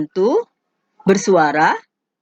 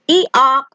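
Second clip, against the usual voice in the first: higher two-note donkey bray; 6 semitones higher, 4 dB louder than usual. Shown as a demonstration. Teacher giving the Indonesian donkey sound, braying. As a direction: imitate a donkey's ee-aw.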